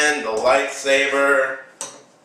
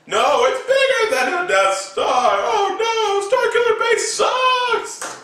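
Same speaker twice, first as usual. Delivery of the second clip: very high whiny panic; mock panicked kid voice; panicked heroes. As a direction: wail it in a high squeak.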